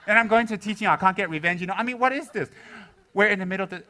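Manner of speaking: ordinary delivery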